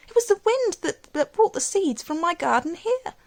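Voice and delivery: delighted. A narrator's reading. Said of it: "in delight".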